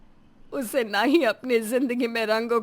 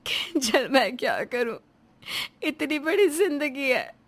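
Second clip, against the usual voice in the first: tearful sobbing. Acted. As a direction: pour out despair through tears. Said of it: "बिलख बिलख कर रोती है". (बिलख-बिलख कर रोते हुए)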